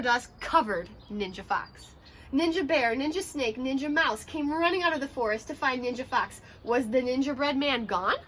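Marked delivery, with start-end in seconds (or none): none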